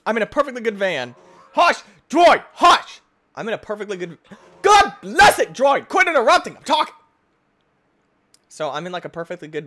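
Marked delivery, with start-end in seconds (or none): 1.5-3.0 sharp loud scolding yell; shouting hush. Real, not acted. Gamer yelling at his interrupting droid, loud scold. 4.6-6.9 loud, high exasperated scolding; angry yell. Real, not acted. Gamer scolding the droid for interrupting, loud and high.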